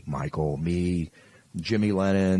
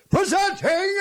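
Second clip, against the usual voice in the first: announcer call; 5 dB louder than usual. Shown as a demonstration. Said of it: "what you do is".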